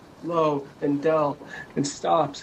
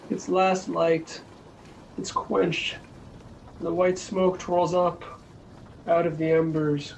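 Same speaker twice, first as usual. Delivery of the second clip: plain reading voice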